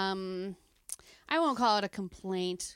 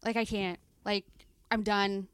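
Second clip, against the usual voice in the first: fed up; much faster than usual. Retold or imitated. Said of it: "I was like".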